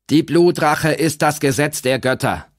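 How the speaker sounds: stubborn repetition, unbending. A narrator's reading. Old mother insisting to Kandamir, stubborn. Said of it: "wiederholte die alte Frau stur".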